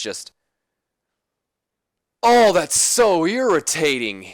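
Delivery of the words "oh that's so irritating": irritated. Real, not acted. Annoyed at his own booking mistake.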